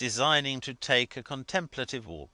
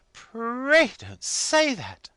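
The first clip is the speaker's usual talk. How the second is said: high, loud, slow pleading wife; urging; 9 dB louder, much slower than usual. A narrator's reading. Wife urging Mrs Boffin, high and pleading. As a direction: beg her warmly not to say it. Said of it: "urged Missus Milvey".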